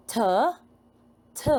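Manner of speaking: normal talking voice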